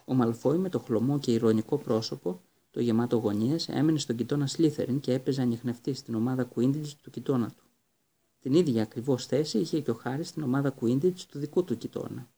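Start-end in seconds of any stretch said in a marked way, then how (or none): none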